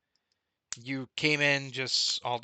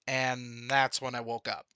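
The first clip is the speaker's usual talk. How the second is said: normal talking voice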